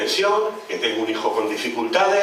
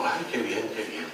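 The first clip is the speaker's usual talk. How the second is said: a hint of a weepy mayor, much softer; mock tearful mayor; 9 dB quieter than usual. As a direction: mimic the mayor nearly in tears, hushed. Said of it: "casi llorando diciendo".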